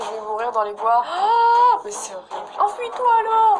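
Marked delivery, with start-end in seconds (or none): 1.1-2.2 horrified cry. Real, not acted. Horror at text message. 2.6-3.6 urgent yell. Real, not acted. Urging the character to flee.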